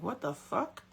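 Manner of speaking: hushed disbelief. Retold or imitated. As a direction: mutter it in quiet disbelief. Confused reaction to sudden panic.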